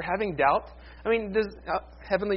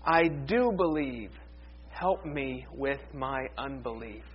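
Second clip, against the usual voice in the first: slow quiet confession; 5 semitones lower, 5 dB quieter, much slower than usual. Retold or imitated. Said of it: "He says".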